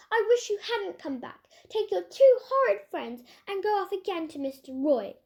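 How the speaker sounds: a hint of a childish tantrum; sulky temper. A narrator's reading. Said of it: "flew into one of her tempers".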